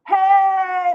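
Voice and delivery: high, loud call across the room; calling the pet Mona from across the room. Real, not acted. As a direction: call out to get her attention.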